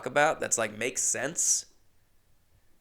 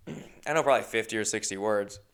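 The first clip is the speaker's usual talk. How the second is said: ordinary talk, nothing marked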